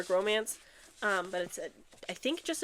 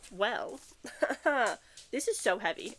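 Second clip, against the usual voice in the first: normal talking voice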